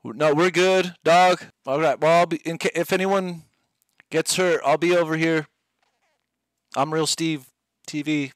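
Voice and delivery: mock players' cool brush-off; dismissive players. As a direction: play snooty strangers waving him off. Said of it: "everyone's like".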